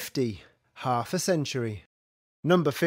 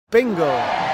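shouting; 5 dB louder than usual. Acted. Calling a bingo win.

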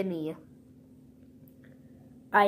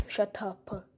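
whisper; 6 dB quieter than usual. Pretend. Hushed snap inside the play.

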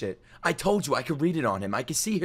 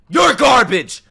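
high, explosive angry yell; pissed off; 10 semitones higher, 11 dB louder, much slower than usual. Real, not acted. Streamer yelling back at the interviewee, pissed off. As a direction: hurl it back as a furious retort. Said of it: "I'm gonna get pissed".

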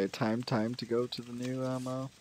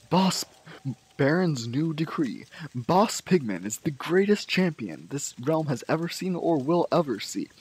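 higher, loud heraldic announcement; grand proclamation; 3 semitones higher, 4 dB louder than usual. Acted. Player proclaims Baron's new decree crowning Boss Pigman, grandly declaimed.

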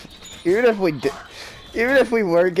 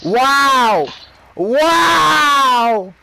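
loud wow; 6 semitones higher, 6 dB louder than usual. Real, not acted. Exclaiming at game event.

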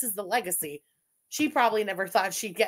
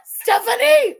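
laughing shriek; 9 semitones higher, 9 dB louder, slower than usual. Real, not acted. Laughing at a chatter's comment.

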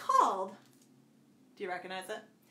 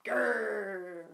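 playful growl like a bear. Shown as a demonstration. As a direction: growl for the kids. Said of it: "Let's do their sounds".

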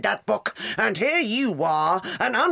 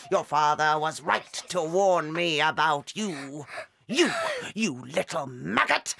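ordinary delivery

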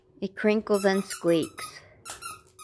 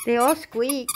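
higher, sing-song voice for the dog; high pet talk; 5 semitones higher, 4 dB louder than usual. Real, not acted. Mom coos to her dog about the squeaky toys, high. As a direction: coo it up to the puppy.

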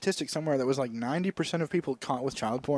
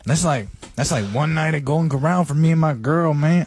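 loud put-on Mexican accent; boisterous bribed cop with a Mexican accent; Mexican police officer; 5 dB louder than usual. Officer taking money and looking away, Mexican accent. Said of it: "a police officer in Mexico".

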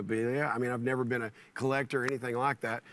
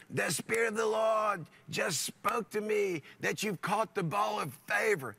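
high, slow imitated British delivery; British accent; 5 semitones higher than usual. Preacher from Harare running up to speaker with news, British accent. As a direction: put on a measured, raised-pitch British accent. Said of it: "in this British accent".

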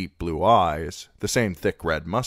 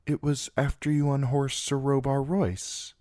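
put-on a touch of desperation, higher girlish voice; pleading to be remembered; desperate girl; 8 semitones higher than usual. A narrator's reading. Sansa presses Sir Loras desperately to remember. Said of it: "she said desperately".

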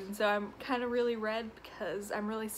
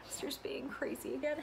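whispered aside; 3 semitones higher, 7 dB quieter than usual. Real, not acted. Aside about the rowdy toddler.

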